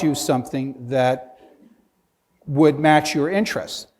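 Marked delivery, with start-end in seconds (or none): none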